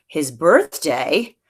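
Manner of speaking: slow, puzzled mock surprise, as if forgotten; Grandma playing dumb about the birthday, drawn-out; feigned surprise. Grandma pretending to Sam she forgot his birthday, slow mock surprise. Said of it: "as if she'd forgotten all about that".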